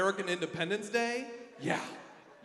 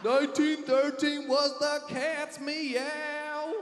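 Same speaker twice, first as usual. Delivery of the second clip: put-on old-timey party guy; 8 semitones higher, 4 dB louder, much slower than usual.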